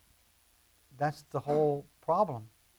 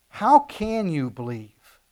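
slow emphatic question; 2 semitones higher, 9 dB louder, much slower than usual. Acted. Slow emphatic scripture quote.

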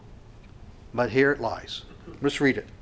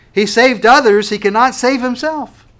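louder, higher, jeering taunt; mocking elders; 9 semitones higher, 10 dB louder than usual. The chief priests mocking Jesus on the cross, jeering. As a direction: sneer it like the scoffers at the cross. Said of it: "the elders were mocking him".

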